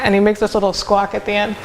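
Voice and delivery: ordinary talk, nothing marked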